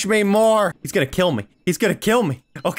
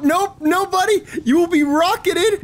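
gleeful taunt; 11 semitones higher, slower than usual. Real, not acted. Gamer taunting cops he is about to rocket. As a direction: gloat over the rockets.

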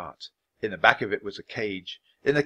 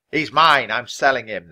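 loud, punchy cart driver; loud protest from an angry owner; angry shout; 3 semitones higher, 9 dB louder than usual. A narrator's reading. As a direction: shout your claim, hardest at the start. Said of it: "the man shouted".

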